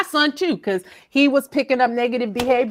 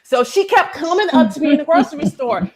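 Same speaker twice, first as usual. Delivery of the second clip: normal talking voice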